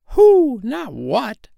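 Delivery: high hooted correction; owl voice. A narrator's reading. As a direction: hoot the first word, then correct him. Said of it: "said an owl".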